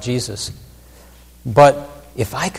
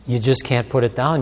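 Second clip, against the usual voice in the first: ordinary delivery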